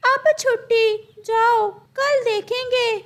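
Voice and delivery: high, bright-pitched teacher bird voice; a high squawky parrot teacher calling on a student. Acted.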